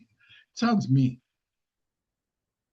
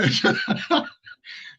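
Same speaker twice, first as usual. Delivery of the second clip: airy laugh rising high; real breathless high-pitched laughing fit; high breathy laugh. Real, not acted. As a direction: burst into helpless laughter. Breaks into laughter.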